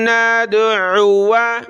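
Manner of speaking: नदउआ drawn out very slowly, no long waw; recitation. Shown as a demonstration. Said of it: "बल्कि".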